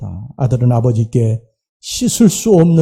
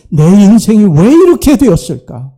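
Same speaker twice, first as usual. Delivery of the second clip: louder, higher cry of why; anguished question; 5 semitones higher, 6 dB louder than usual. Acted. Prodigal son crying out over his ruined life, louder.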